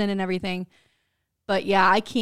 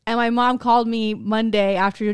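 ordinary talk

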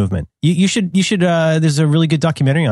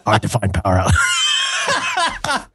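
high, slow hammed-up raspy voice; Selma voice; much slower than usual. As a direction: stretch it out in a comic old voice. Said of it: "Now I sound like Selma".

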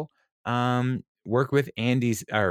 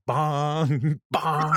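higher-pitched mock "bong" with a laugh between; playful retold taunt, pitched up; imitated childhood bully; 6 semitones higher than usual. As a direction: imitate a kid calling out as he punches. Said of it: "hit me really hard in the arm and go".

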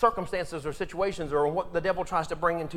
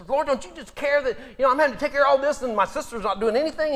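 exasperated complaint; 6 semitones higher, 5 dB louder, faster than usual. Acted. Martha complains to Jesus.

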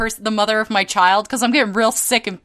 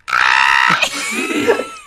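shrieking laugh, much higher; pterodactyl screech laugh. Real, not acted. Laughing at the nipple chain story, pterodactyl shriek. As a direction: let out a screeching pterodactyl noise. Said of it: "Pterodactyl noise".

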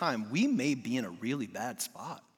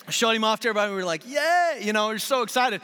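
bright, higher, louder burst of joy; excited; 7 semitones higher, 6 dB louder, faster than usual. Retold or imitated. Father showing off his newborn, excited and loud. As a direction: relive the thrill, voice lifting.

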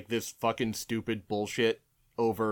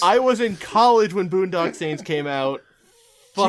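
loud, raised-pitch protest; exasperated outburst; 8 semitones higher, 10 dB louder than usual. Real, not acted. Host protesting a Boondock Saints reference, loud exasperation. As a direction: blurt it out loudly, exasperated.

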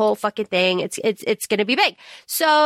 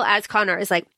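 normal talking voice

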